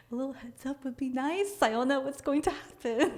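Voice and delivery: high-pitched, half-laughing complaint; flustered high re-enactment; nervous protest. Retold or imitated. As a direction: squeak it out as mock alarm. Retold nervous complaint to friend.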